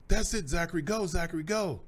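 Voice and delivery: high, loud cheer from the stands; cheering yell. Retold or imitated. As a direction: yell encouragement across the field. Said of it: "I yelled at him".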